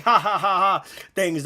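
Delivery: a short hollow mock laugh; fake forced laugh. Shown as a demonstration. Showing his friend what a fake laugh sounds like. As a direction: laugh on purpose with no joy in it. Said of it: "one of these little fake".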